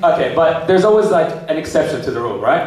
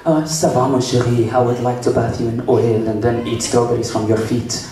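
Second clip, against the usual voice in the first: seductive mock Frenchman; French accent; 5 semitones lower than usual. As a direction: woo in a put-on French accent. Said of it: "maybe a French guy who'd be like".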